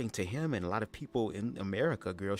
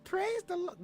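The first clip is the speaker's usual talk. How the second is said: very high drawn-out amen; high stretched-out praising amen; church lady praising; much slower than usual. Retold or imitated. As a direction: cry amen like a churchgoer at the end of the film. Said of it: "Remember when she came at the end".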